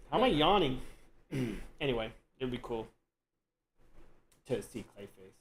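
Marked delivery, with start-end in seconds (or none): none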